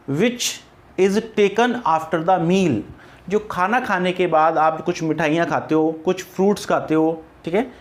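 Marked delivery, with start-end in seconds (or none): 0.1-2.7 very slow, word by word; teacher dictating a definition; slow dictation. Shown as a demonstration. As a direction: dictate is taken after the meal slowly. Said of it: "dictating definition".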